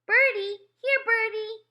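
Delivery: put-on high, loud little-boy call. A narrator's reading. Little brother calling to birds, high loud call. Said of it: "he called".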